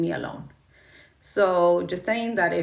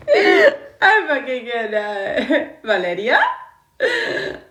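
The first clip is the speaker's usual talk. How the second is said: higher and louder, words squeezed out through laughter; can't stop laughing at what she just caught; laughing so hard it nearly turns to crying; real laughing fit; 6 semitones higher, 7 dB louder than usual. Real, not acted. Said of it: "Sorry, you guys, it's 'cause I caught Chino".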